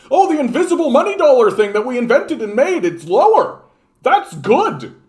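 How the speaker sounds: sarcastic parody of a boomer; mocking boomer voice. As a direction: ridicule him by sounding clueless.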